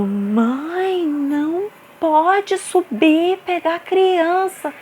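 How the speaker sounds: mock-stern scold; stern staff voice. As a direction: imitate the worker telling her off. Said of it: "E ela falando".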